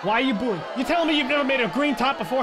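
loud, fast indignant yell at the chat; indignant shout. Real, not acted. Gamer yelling at chat for booing his house, loud indignant. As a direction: shout back at the imagined booing crowd.

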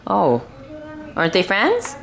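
ordinary talk, nothing marked

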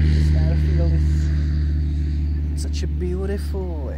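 slow, loud, amazed praise; awed. Real, not acted. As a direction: savor every word in awe. Awed at airfield.

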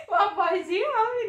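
high, giggly aside; amused at your own put-on voice; laughing. Real, not acted. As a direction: say it through laughter. Laughs at her own God voice.

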